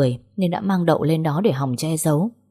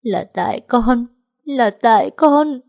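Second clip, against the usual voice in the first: slow, high, rising to a loud broken wail; mournful wail; 6 semitones higher, 4 dB louder, slower than usual. A narrator's reading. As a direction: blame yourself through tears, slowly. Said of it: "phát ra những tiếng nói thê lương".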